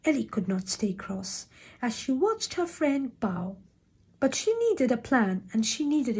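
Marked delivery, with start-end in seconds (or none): none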